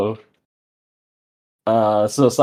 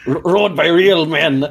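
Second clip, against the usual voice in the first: put-on pirate accent; 4 semitones higher than usual. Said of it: "stereotypical pirate, then he goes".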